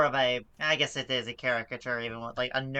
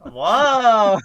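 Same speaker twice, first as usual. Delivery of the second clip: surprised whoa; 7 semitones higher, 13 dB louder than usual. Real, not acted. Reacting to Tom's rude line.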